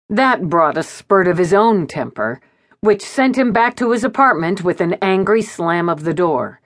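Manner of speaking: plain reading voice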